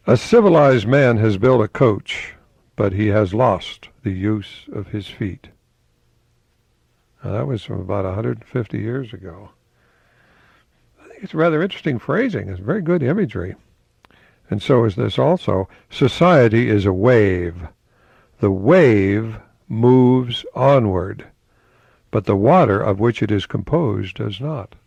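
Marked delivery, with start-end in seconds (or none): 0.1-5.5 louder start, slow finish; quote reading. Acted. Host quoting Emerson to viewers, slow declamation. 15.9-24.8 slow, louder recitation; quote reading. Acted. Host quoting Emerson to viewers, slow declamation.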